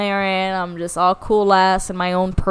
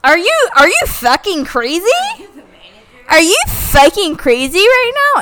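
high, louder indignant yell; retold outrage; 10 semitones higher, 5 dB louder than usual. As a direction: confront her as if she's insane. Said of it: "I was like".

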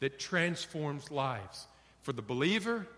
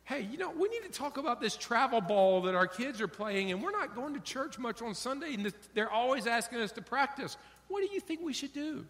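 higher, hurried wife voice; mock anxious wife; 6 semitones higher, faster than usual. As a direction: rush through the worries up high. Wife raising family worry.

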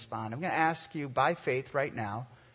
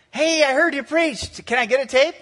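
higher, louder eager father on the phone; eager father calling his son, higher and louder; dad asking; 10 semitones higher, 11 dB louder than usual. Retold or imitated. Dad asks for tape.

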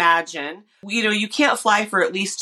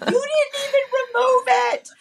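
very high, squealed indignation through laughter; hammed-up, high-pitched complaint breaking into laughs; mock outrage. Patient retells fury at surgeon in dream, mock outrage. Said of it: "I was really mad".

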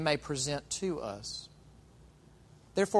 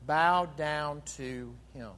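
slow emphatic; much slower than usual. Acted. Preacher urging worship.

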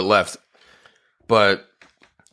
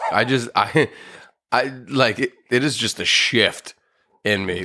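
ordinary talk

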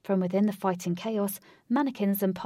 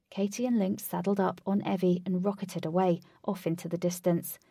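usual speaking voice

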